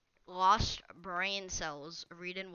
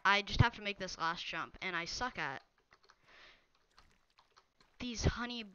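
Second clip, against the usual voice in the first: usual speaking voice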